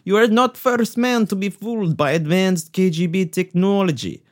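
hammed-up Russian villain gloating; spring the trap in a cartoon Russian accent; mock Russian accent.

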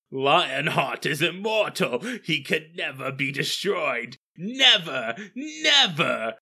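theatrical boast rising to a loud, high finish; Lionheart. Acted. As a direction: proclaim it like a hammy stage actor, climbing high on the last word. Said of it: "the exact words he says are".

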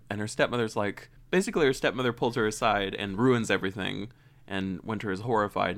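usual speaking voice

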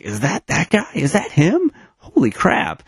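suddenly spot a familiar face; surprised recognition. Retold or imitated. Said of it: "that you're like".

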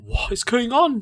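high, slow baffled question; exasperated disbelief. Retold or imitated. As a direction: throw up your hands at the confusion. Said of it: "I was like".

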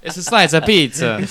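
put-on slow, laughing Italian accent. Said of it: "Don't use an Italian accent".

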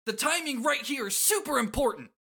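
very high, eager character voice; high-pitched hype; Shooter. Acted. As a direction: gush up high like a game fanatic. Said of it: "Shooter says".

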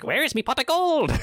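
high Irish-style impish voice; leprechaun. Retold or imitated. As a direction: squeak it out like a mischievous little sprite. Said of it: "like, Leprechaun".

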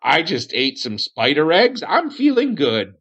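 high, wavering sing-song hermit voice; Aniston, dotty cave hermit insisting he's fine. Acted.

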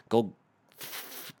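long sputtering "f-" before a drawn-out "fuck yourself"; deliberately held F. Acted. Telling homeless people off, deliberately held F. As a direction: hang on the F of "fuck", then burst out. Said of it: "I meant to do the f".

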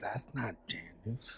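slow, very soft mimicked realization; quiet, drawn-out mock dread; hushed dread. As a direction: act out backing away, almost under the breath. Voices the friend's frightened realization.

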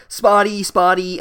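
loud, high teasing name-calling; mock taunting. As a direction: jeer the nickname like a playground bully. Peeves keeps taunting Myrtle.

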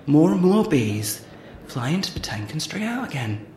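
ordinary talk

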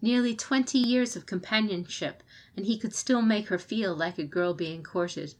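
ordinary delivery

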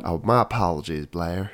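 very low mock-courtly DJ; deep radio voice. Podcaster apologizing to co-host in character, deep sultry voice. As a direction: apologize smoothly from the bottom of your range.

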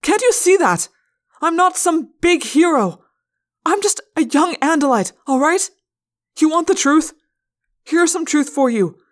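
high, loud, anguished confession; distraught yelling. A narrator's reading. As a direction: pour it out at full voice. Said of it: "I yelled".

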